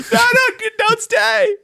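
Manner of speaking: high loud wail of don't stay; crying out. Retold or imitated. Acting out crying at movie.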